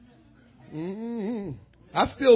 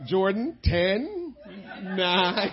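mock countdown; 4 dB quieter, much slower than usual. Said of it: "she count down".

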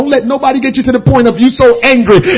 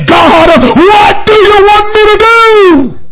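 much higher, slow loud cry; shouted plea to God; 9 semitones higher, 5 dB louder than usual. Retold or imitated. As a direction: beg God for direction at full voice. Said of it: "and say".

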